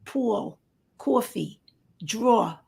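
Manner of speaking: thick New York vowels, word by word; New York accent demo; old New York accent. Shown as a demonstration. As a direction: demonstrate New York "pool", "coffee", "draw". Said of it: "I used to talk".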